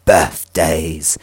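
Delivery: slow, exaggeratedly posh British accent; put-on posh British pronunciation. Shown as a demonstration. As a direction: draw it out like posh British gentry. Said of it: "say it in a posh accent".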